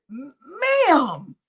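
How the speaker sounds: ordinary delivery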